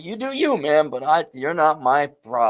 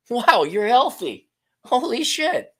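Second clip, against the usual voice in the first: mock amazement; 11 semitones higher, 4 dB louder, slower than usual. Imitating an impressed partner.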